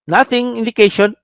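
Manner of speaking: ordinary talk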